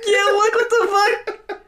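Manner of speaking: high, slow laughing outburst; laughing disbelief. Real, not acted. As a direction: laugh out the question.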